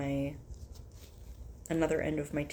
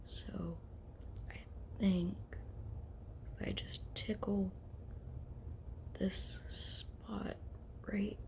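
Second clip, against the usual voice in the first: whispering; 3 semitones higher, 11 dB quieter, slower than usual. Real, not acted. Quietly tickling nose.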